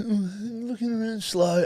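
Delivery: high, slow, dreamy mock voice; dozy slow walker. Mocking slow walkers who block the footpath, dreamy and slow. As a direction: drift through it like an oblivious dawdler.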